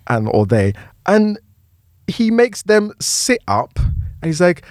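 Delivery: usual speaking voice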